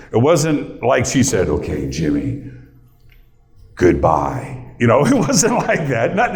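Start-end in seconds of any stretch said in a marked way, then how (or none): 1.5-4.6 imitating a grandma saying goodbye, lower voice; a lightly voiced grandma bidding farewell, lower; imagined grandma farewell. Host imitating his late grandma saying goodbye, lower voice.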